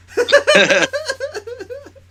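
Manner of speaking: a big, high burst of laughter; loud high laugh. Real, not acted. Laughing after the Drax joke.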